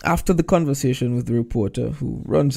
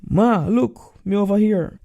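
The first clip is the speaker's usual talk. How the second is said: calling out; 9 semitones higher, 3 dB louder than usual. Retold or imitated. Tolroy calls to family.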